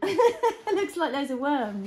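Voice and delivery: loud laughing remark. Real, not acted. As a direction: laugh through the words. Laughing at picture.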